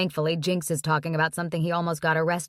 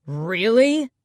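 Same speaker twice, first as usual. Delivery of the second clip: loud, indignant snap; angry snap; 3 semitones higher, 7 dB louder than usual. A narrator's reading. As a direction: bite it off, offended. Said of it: "Bishop snaps like he has a right".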